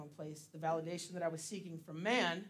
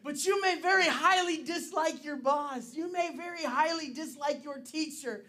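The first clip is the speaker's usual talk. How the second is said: raised, louder preaching voice, high and driving; 8 semitones higher, 8 dB louder than usual. Acted. Pastor preaching to the congregation, loud and raised. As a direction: preach it to the room with full force.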